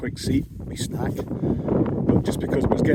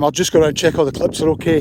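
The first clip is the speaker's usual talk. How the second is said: loud over howling wind; shouting over wind; 8 dB louder, faster than usual. Real, not acted. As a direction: push your voice over the gale. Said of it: "hear me over this wind".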